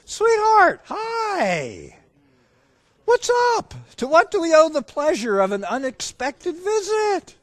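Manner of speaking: high, gushing dad welcome; excited greeting. Retold or imitated. Dad running out to greet his daughter, acted excitement. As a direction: greet her in over-joyed high pitch. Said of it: "I was excited".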